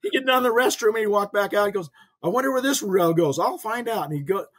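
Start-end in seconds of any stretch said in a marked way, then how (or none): 2.2-4.0 higher-pitched imitation of an old dad; father wondering aloud. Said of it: "you'd love to hear him".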